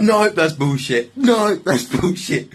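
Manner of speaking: slow, high, mock-indignant no; retold protest. Storyteller to police, slow high indignant protest. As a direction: reenact shouting down the accusation.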